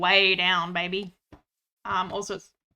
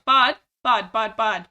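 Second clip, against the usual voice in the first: louder calls to the horse; calling out; 8 dB louder, slower than usual. Real, not acted. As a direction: try to get the horse's attention.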